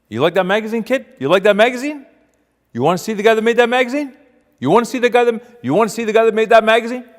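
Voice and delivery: loud, high-pitched, escalating questions; worked-up bus driver egging someone on; excited goading. Acted. Driver hypes up the kid.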